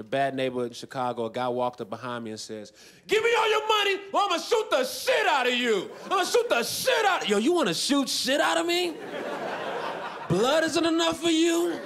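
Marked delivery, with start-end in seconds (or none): 3.1-7.4 high, shouted hold-up demand; mock robber threat. Acted robber threatening from behind. 7.4-11.8 mock incredulous protest. Mock outraged reply to the robber.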